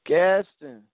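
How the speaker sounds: loud, high echo of the name; surprised. Real, not acted. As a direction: blurt the town back in disbelief.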